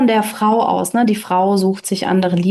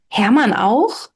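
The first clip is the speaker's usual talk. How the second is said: astonished; slower than usual. Acted. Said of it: "fragte Hans erstaunt".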